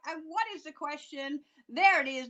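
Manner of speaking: ordinary talk, nothing marked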